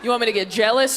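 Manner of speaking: normal talking voice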